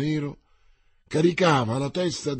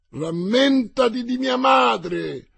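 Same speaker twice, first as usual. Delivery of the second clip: much higher, loud, very slow cry; vengeful yell; 9 semitones higher, 5 dB louder, much slower than usual. A narrator's reading. Mordaunt yells at dying Winter, vengeful. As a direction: hurl it back while galloping past. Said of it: "urlò Mordaunt".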